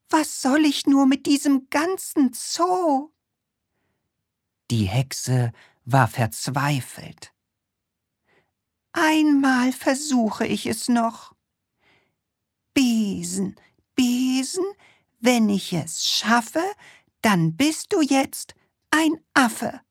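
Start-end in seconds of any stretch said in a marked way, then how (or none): 0.1-3.1 high witch voice, exasperated; despairing witch. Acted. The witch despairs at her zoo, high and exasperated. 8.9-19.8 high witch voice chanting the rhyme; one last try, chanted like a witch; witch casting spell. Acted. The desperate witch tries once more, high chanted spell.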